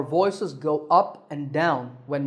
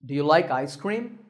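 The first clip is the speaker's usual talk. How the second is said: "Do you like ice cream" going up at the end; rising tone; 2 semitones lower than usual. Shown as a demonstration. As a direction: raise the voice through ice cream. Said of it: "up at the end".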